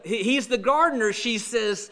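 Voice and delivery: usual speaking voice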